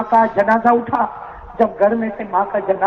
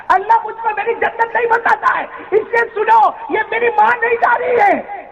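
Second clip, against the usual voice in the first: climbs higher and higher into a wailing shout; grief-stricken outcry; 11 semitones higher than usual. Retold or imitated. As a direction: build from sobbing lament to a full cry. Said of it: "کہا". (غم سے چیختی ہوئی بلند ہوتی پکار)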